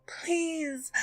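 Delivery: a high, pleading cry of please; begging. Acted. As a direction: beg the monster desperately. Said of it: "I begged".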